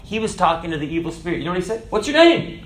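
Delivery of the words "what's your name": high-pitched challenge, a little louder; commanding question. Acted. Jesus questioning the evil spirit, commanding question. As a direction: demand it high and forcefully.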